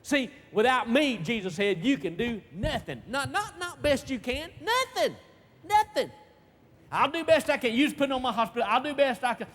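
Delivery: high, loud pulpit delivery; full-force preaching. Real, not acted. Preacher driving home "without me" to the congregation, full-force preaching. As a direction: hammer it out to the congregation.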